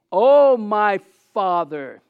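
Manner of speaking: loud, very slow, drawn-out prayer; crying out. Retold or imitated. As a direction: cry out to the Father, stretching each word. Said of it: "Luke says he cried out".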